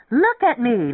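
high, loud shout. A narrator's reading. Lemming waves his arms at the room, shouting. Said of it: "Lemming shouted".

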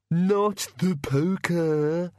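high, long-drawn monster whine; high, dragged-out pleading; whining bogeyman. Acted. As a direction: whine it slowly as a cowed bogeyman. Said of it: "whined the bogeyman".

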